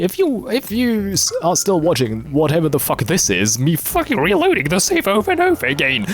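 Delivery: high-pitched exasperated rant. Real, not acted. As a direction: let frustration with the game push the voice up. Stuck reloading a game save.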